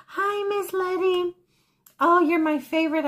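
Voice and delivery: high, sing-out retold hello; gushing greeting. Customer greeting her favorite volunteer, high and gushing. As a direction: greet her high and delighted, stretching the name.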